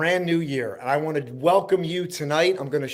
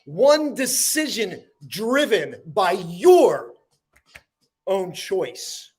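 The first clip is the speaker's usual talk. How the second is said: slow, emphatic spoken-word delivery, high attacks falling away; dramatic poem recital; 7 semitones higher, 5 dB louder, slower than usual. Acted. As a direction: declaim each line, pausing before the last words. Recites own motivational poem.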